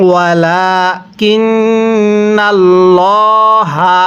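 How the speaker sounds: long drawn Quranic chant with a rising end; slow recitation. Shown as a demonstration. Teacher reciting the next word to students, slow chant. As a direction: stretch every vowel like a reciter. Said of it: "হরফটি এক আলিফ টেনে পড়তে হয়।".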